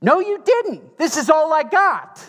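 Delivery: very high, loud, exasperated sass; sassy indignant widow. Retold or imitated. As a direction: fling it at him, high and loud. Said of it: "this is what that sounds like".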